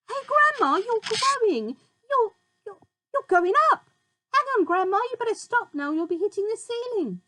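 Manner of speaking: high, loud alarmed shout. A narrator's reading. George shouting up at growing Grandma, alarmed. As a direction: yell up at her in alarm. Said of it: "George gave a jump and shouted".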